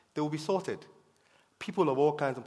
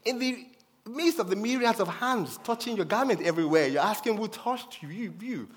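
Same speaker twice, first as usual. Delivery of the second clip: incredulous disciples; 7 semitones higher than usual. Retold or imitated. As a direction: scoff at the question as if it is absurd. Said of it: "And the disciples were saying".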